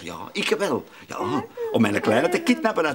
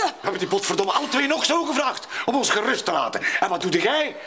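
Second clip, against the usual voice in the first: angry reproach; 8 semitones higher than usual. Acted. Angry quarrel over missing child.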